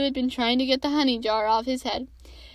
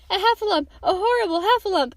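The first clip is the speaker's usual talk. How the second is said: high, squealing alarm; frightened little creature crying out; panicked cry; 6 semitones higher, 4 dB louder than usual. A narrator's reading. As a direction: raise the alarm in terror, pitched up. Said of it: "cried Piglet".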